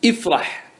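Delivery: "افرح" as ifrah, hamza with kasra; Tamimi kasra prefix. Shown as a demonstration. Teacher to class, Tamimi kasra on hamza, clear demo. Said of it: "يجوز لك أن تكسر".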